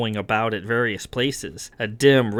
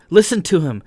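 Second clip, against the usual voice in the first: raised, pressing plea; higher, louder, anxious insistence; urgent warning; 5 semitones higher, 4 dB louder than usual. Acted. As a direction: urge everyone to keep still. Character backs an urgent warning.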